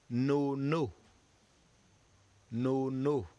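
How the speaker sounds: "no, no" said as French syllables; French word drill. Shown as a demonstration. Teacher models the French word for students. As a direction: model "no, no" clearly for the class. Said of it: "And the last one".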